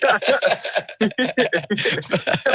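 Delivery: loud high burst of laughter. Real, not acted. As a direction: let out a big laugh. Shared laughter.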